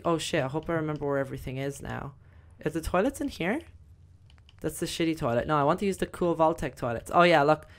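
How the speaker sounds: ordinary delivery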